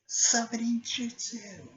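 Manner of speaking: high, loud, drawn-out battle cry; imitated technique call. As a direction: call out the jutsu long and high. Calling out the technique.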